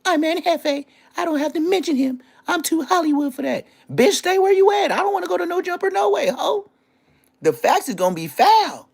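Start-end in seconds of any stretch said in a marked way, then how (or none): 0.0-3.7 mocking a rival as a high, crying diva; mocking whiny diva. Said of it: "The bitches gonna go in their room". 3.9-6.7 mock voice. Mocking rival's shrill retort.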